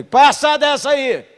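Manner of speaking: high, slow yelled command; shout. Retold or imitated. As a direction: bellow it across the room. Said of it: "Gritei duas vezes".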